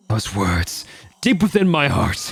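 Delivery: building from eager to a high shouted "Ha!"; rising frenzy. Acted. Taka bursting with new fighting spirit, rising to a shout. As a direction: burst out of your shell.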